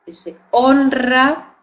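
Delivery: honra slow and high, r trilled; stressed honra. Shown as a demonstration. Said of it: "la R va detrás de la N".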